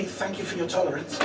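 dry, quiet sarcastic thank-you; ironic thanks. Retold or imitated. Comic leaving the stage, quietly ironic thanks. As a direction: thank them with a straight face, dripping irony. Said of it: "with a great deal of irony".

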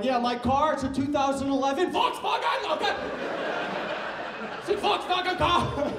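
overpronounce Volkswagen like a proud German; mock German accent. German guy explaining his car, mock German accent. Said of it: "no German guy walking around going".

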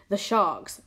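usual speaking voice

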